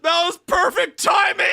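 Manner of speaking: high, loud crying laugh; cracking up at the crash; laughing shout. Real, not acted. Game crashed mid-scene.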